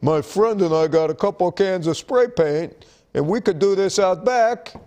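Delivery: put-on doofus voice, climbing in pitch; dumb guy voice. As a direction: play a clueless guy pitching his big idea. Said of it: "that's my dumb guy voice".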